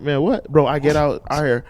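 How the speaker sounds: normal talking voice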